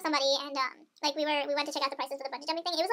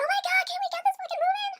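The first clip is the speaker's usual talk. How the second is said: high, frantic yelling; freaked out on a stuck lift; panicked shriek; 3 dB louder than usual. Retold or imitated. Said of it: "screaming down the hill".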